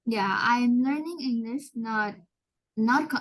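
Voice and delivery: normal talking voice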